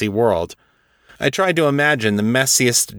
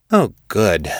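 playful woman. Acted. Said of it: "Tara said".

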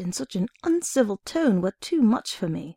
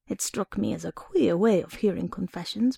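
plain reading voice

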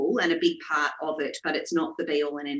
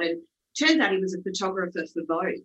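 ordinary talk, nothing marked

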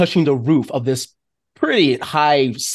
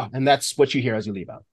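usual speaking voice

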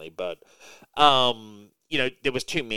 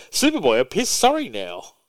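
high, loud cartoon dog; mock doggy complaint; hammed-up hurt pet; Crypto voice; 6 semitones higher, 7 dB louder than usual. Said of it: "Crypto's like".

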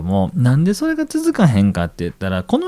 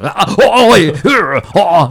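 alien voice; 8 semitones higher, 9 dB louder than usual. Retold or imitated. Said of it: "メインブラックに出てくる宇宙人と同じぐらいの口調".

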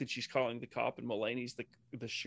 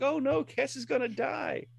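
high-pitched mock worry; hammed-up dread, pitched way up; mock alarm; 12 semitones higher than usual. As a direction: fret in a much higher voice, playfully. Mock alarm retelling a scene.

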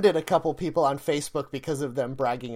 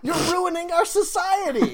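high, indignant mock wail; exasperated rant; 12 semitones higher than usual. Podcaster accusing life-hack people, high comic outrage. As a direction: cry out against them, pitched way up. End of the acted retort.

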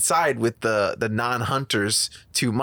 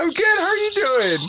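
much higher, sweet and polite; 3 dB louder than usual. Real, not acted. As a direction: talk up high and sweetly. Chatting with friend's mom.

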